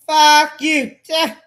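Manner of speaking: loud angry shout; yelled curse. Real, not acted. Ranting at Todd, a loud furious yell. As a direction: throw the curse out hard.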